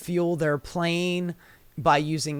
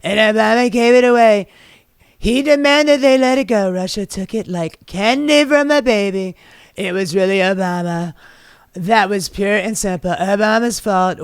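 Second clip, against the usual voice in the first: louder, higher mocking Trump voice, boastful; Trump impression; 3 semitones higher, 6 dB louder than usual. Host mocking Trump blaming Obama, hammed-up Trump voice. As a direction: ham up Trump bragging about Crimea.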